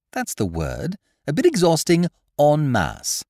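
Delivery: put-on breezy young master; young gentleman. A narrator's reading.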